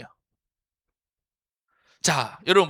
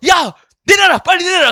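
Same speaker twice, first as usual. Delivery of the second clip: shrill, much higher, loud frantic yell to take it down; panicked yelling; 14 semitones higher, 15 dB louder than usual. Retold or imitated. Pastor yelling at his friend to take the old photo down, frantic shout. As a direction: scream at the friend to delete the photo now.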